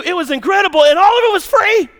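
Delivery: loud, high, thrilled; excited shout. Real, not acted. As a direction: burst out with delight. Thrilled retelling of free VIP game.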